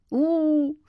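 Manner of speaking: plaintive little howl; child's mock wail. A narrator's reading. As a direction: wail lightly like a pitiful boy. Boy mimes his coming misery.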